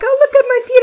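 high, loud delight at own feet; cat gushing loudly over its own feet; admiring own feet. Retold or imitated. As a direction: squeal it out, thrilled with your feet. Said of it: "I'm like".

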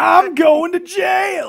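high, loud, drawn-out mock wail; mock-alarmed wail. Joking to friends about the trap-off, mock-alarmed wail. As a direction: cry it out high and slow, hammed-up.

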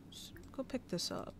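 soft unvoiced whisper; whispered aside. Real, not acted. Quietly telling her Sim to pick something up, whispered. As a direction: murmur it under your breath. Aside instruction while playing.

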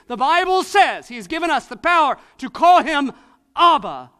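preaching at full force. Acted. Preacher urging the congregation to draw near, full-force preaching. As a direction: build it up and lean into every line.

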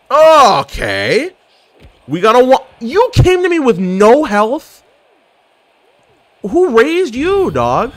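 trash-talking a weak opponent in high mock disbelief; mock outrage. Real, not acted. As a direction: scold like an appalled parent. Scolding enemy who came with no health.

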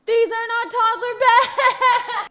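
laughing exclaim. Real, not acted. Discovering wrong beds.

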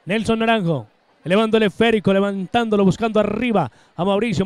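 normal talking voice

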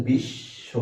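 বিশ্ব broken after বিশ, pause, then শ; two beats in বিশ্ব, বিশ-শ; syllable by syllable. Shown as a demonstration. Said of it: "কয়বারে বাধা পাচ্ছেন".

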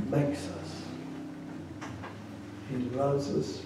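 soft, intimate sermon voice; gentle heartfelt pastor murmur; hushed tender preaching. Acted. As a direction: draw them close with a quiet plea.

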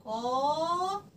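"قا" held long with pitch gliding up; long madd syllable. Shown as a demonstration. Teacher sounding out syllable to write, vowel drawn out. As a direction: sustain "قا", sliding gradually upward. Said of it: "مقطع المد".

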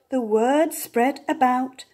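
usual speaking voice

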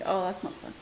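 ordinary talk

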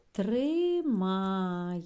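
Тримає called out loud, the ending stretched; teacher modelling Тримає; loud model word. Shown as a demonstration. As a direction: sound out Тримає clearly. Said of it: "де може бути є".

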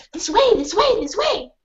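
high eager yaps; excited dog voice; urgent dog. Acted. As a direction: call them along as the dog. Dog leading.

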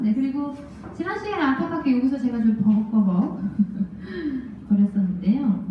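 normal talking voice

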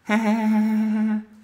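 lautes, hohes Auflachen; loud laugh. Real, not acted. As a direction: lach laut los. Lacht über Höllenlevel. (lautes Lachen)

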